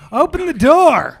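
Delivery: high, loud, slow demand; commanding shout. Acted. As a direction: bellow it through the door. Guard pounding at the door.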